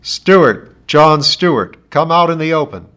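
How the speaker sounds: loud, booming loudspeaker call; iron voice from the sky. A narrator's reading. Ship's loudspeaker ordering John Stewart out, booming. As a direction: boom it down from a hovering ship. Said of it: "an iron voice rumbled out of the sky".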